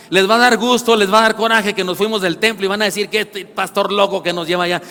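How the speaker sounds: loud, high preaching; preaching at full force. Acted. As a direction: build the sermon to a loud climax. (predicando con fuerza, alto y fuerte)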